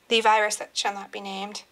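ordinary talk, nothing marked

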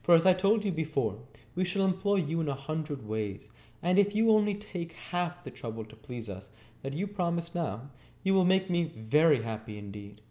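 high, smiling lady's voice; kindly young lady. Acted. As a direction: play a gracious lady reassuring a boy. Voices Rose in the story.

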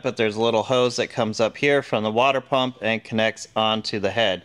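normal talking voice

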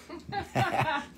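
high squeaky burst of laughter; a sudden high-pitched giggle; laughing high at his own joke. Real, not acted.

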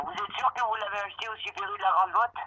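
usual speaking voice

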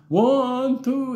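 high, loud halting count; high counting boy. Retold or imitated. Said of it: "he would go".

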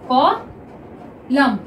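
Column stretched slowly; slow drawn-out reading. Shown as a demonstration. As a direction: read column slowly for students. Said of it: "next देखिए column". (Column को धीरे पढ़ना)

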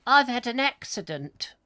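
high squeaky catchphrase voice; Joe Pasquale. Retold or imitated. Host recalls the advert, high squeaky comic voice. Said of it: "that was Joe Pasquale".

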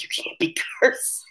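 whispered warning to a coworker; hushed scolding. Retold or imitated. Telling her coworker off under her breath, whispered. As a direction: hiss it so the camera won't catch it.

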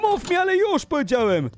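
high-pitched insistent yell; angry demand. Acted. YouTuber demanding the stalker speak, high angry yell. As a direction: demand it in mock rage.

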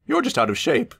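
high, prim coach; Annie's voice. A narrator's reading. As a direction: tease him primly. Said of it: "said Annie".

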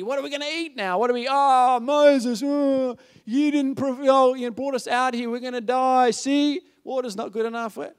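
high, loud hammed-up whining; overdone panicky griping; mock wailing complaint. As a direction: wail like an exasperated, grumbling crowd. Acting out hungry, complaining Israelites.

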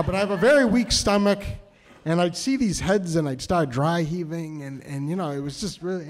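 ordinary talk

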